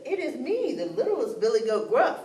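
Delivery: a hint of a small goat; lightly voiced little goat. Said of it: "the littlest billy goat said".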